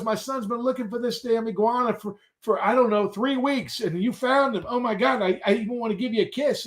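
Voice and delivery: high, gushing imitation of a woman; mock delighted lady; grateful lady customer. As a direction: gush up high as the relieved customer. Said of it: "She goes".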